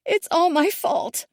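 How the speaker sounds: blaming herself; anguished. A narrator's reading. Daughter gazing at the water, anguished. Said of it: "she says".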